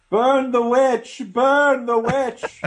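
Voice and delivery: slow, high mob chant; rally the mob, drawn out; angry mob leader. Pretend. Mocks union crowd as witch hunt.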